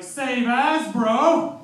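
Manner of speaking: high, loud, over-keen slang; loud eager Kiwi-slang try; eager mock shout. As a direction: blurt it out like a proud new local. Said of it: "And I was like".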